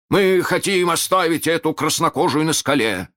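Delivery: high shout across the water. A narrator's reading. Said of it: "крикнул Неряха".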